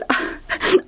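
short breathy laugh; chuckle. Acted. Lucius amused at his own answer, chuckling. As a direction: let a small laugh escape.

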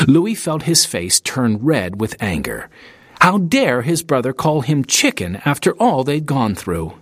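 usual speaking voice

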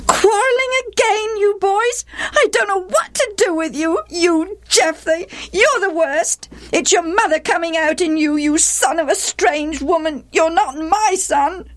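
very high, scolding stepmother; overwrought outburst. A narrator's reading. Stepmother rages at the quarrelling boys, overwrought. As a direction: let it all out in a high-pitched tirade. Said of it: "one day she let it all out".

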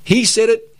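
high mock voice of a know-it-all believer; mock eager churchgoer. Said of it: "I can just about hear people say".

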